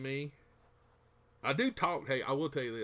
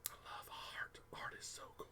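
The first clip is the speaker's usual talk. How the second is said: whispering; 13 dB quieter than usual. Real, not acted.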